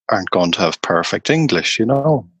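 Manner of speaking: ordinary talk, nothing marked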